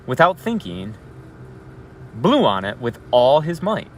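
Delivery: storyteller voice building to the climax; story read-aloud. Acted. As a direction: read the rising tension to the class. Teacher reads story aloud.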